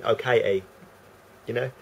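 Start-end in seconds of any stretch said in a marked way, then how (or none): 0.0-0.6 cockney accent, Katie said as Kai-ie with a glottal stop for the t; casual cockney London greeting. Shown as a demonstration. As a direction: drop into a broad London cockney. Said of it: "to going".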